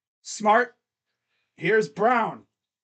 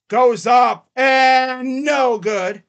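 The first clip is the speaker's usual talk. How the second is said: louder, drawn-out call of no good; excited shout; 6 semitones higher, 8 dB louder, much slower than usual. Real, not acted. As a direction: shout the miss like a broadcaster. Calling a drive live.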